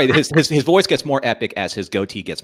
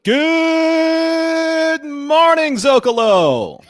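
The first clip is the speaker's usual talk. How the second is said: high, stretched, drawn-out morning call; radio host call; 4 dB louder, much slower than usual. Acted. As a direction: sing out a big morning greeting. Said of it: "start the whole show with".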